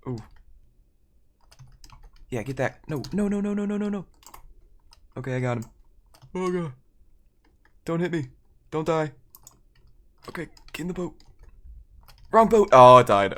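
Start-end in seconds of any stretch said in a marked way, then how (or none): 2.9-4.0 panicked no's. Real, not acted. Panicking in game. 12.3-13.3 a sudden loud cry over the wrong boat; loud dismay. Real, not acted. Game mishap.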